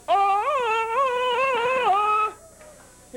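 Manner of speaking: high, wavering jungle call; Tarzan yell. Retold or imitated. Brother mocking his little brother's jungle cry, high and wavering. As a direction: yodel it like Tarzan. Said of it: "he's going".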